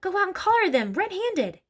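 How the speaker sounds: high-pitched, insistent order; urgent hiss. A narrator's reading. Mother pushes her reluctant husband to act, hissing urgently. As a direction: urge him on in a high voice. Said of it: "hissed the mother".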